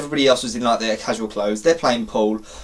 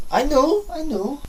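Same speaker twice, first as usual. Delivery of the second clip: very high mock agreement; high-pitched put-on voice; 13 semitones higher, slower than usual. Storyteller acknowledging the absurd directions, high-pitched.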